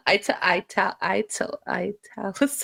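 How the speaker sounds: soft, broken tries before "italicized" comes out; stumbling over a word. Real, not acted. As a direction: fumble quietly toward "italicized". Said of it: "took a minute there to get that word out".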